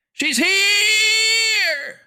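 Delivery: long, piercing high scream; full-out shriek. A narrator's reading. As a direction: scream it out and hold the last word. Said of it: "the voice screamed".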